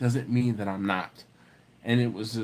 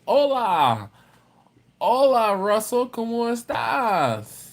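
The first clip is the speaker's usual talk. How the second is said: high, loud, stretched-out hola; over-the-top Spanish greeting; 9 semitones higher, 5 dB louder, slower than usual. Real, not acted. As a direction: belt out a big showy Spanish hello. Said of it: "don't know why I sound like that".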